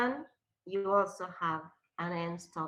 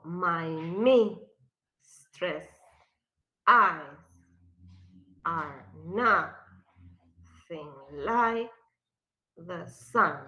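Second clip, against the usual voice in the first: each word said apart with a beat; stressed reading; 3 semitones lower, 4 dB louder, slower than usual. Shown as a demonstration. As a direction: tap out the meter word by word. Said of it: "¿dónde irían los stresses?".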